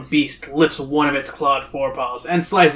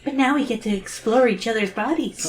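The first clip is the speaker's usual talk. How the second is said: put-on high, soft girl voice; mock-innocent, high and hushed; Zoe; 7 semitones higher, 4 dB quieter than usual. Reader joking as Zoe to her friend, high voice. Joke continuation in Zoe's voice.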